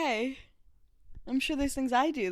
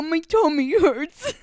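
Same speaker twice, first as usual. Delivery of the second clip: put-on slow, louder whiny baby complaint; mock whiny; 4 semitones higher, 8 dB louder, slower than usual. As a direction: mimic a pouty little kid. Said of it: "When you go".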